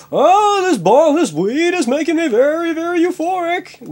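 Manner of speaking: very high, loud stoned voice; mock stoned squeal. [name] describes the weed after sex, very high mock bliss.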